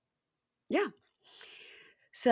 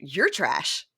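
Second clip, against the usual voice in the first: lower-pitched retort; mocking echo; 5 semitones lower than usual. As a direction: throw it right back with stress on YOU'RE. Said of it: "It's all he does is repeat it back".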